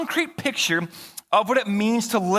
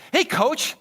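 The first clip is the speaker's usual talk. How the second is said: lightly voiced young boy calling out, higher; imitating a kid; 8 semitones higher than usual. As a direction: chirp it like a curious kid. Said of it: "Quinn was like".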